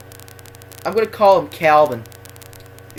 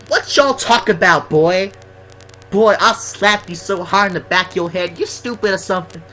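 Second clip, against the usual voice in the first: mock a threatening bad kid, pitched up and louder; Calvin; 5 semitones higher, 5 dB louder than usual. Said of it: "but he speaks like".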